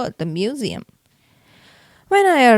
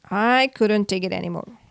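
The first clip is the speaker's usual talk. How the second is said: usual speaking voice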